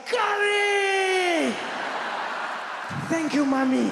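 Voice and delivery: put-on very high, very loud child's shout of delight; joyful scream. Young [name] echoing his friend, ecstatic high shout. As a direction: scream your thanks with pure joy. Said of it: "I was like".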